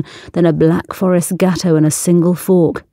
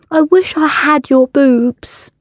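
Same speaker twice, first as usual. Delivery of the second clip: higher, small wistful voice; girlish confession; 8 semitones higher than usual. Acted. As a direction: confide it through a mouthful of cake. Ella through mouthful, confiding.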